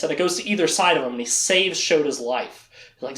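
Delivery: ordinary talk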